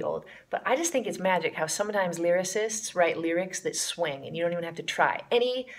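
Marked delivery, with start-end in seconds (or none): none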